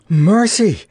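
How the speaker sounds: high, loud gasp of "Mercy"; gasped exclamation. A narrator's reading. Betsy, just landed with a scratched knee, gasps. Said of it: "gasped Betsy Bobbin".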